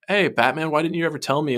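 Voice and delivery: lightly voiced Harley. Harley asking Batman about being a hero, lightly voiced.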